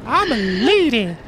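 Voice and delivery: high, slow put-on lady voice; falsetto lady. Joking he's just being a lady, high slow falsetto. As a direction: play a prim lady, drawn out. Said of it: "I'm just being a lady".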